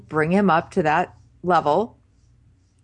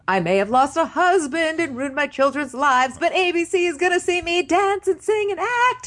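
hammed-up Broadway mom; 10 semitones higher than usual. Mother auditioning for Broadway, high theatrical diva voice. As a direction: belt it like a stage mother in her big moment. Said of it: "acting like it was, like, her audition for Broadway".

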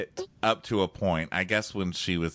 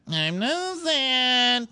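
very high, drawn-out fake wail; mock whine; 3 dB louder, much slower than usual. Rejected woman denying she is upset, high mock whine. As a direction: whine it slowly, imitating a sulky woman. Mocking her denial of being sad.

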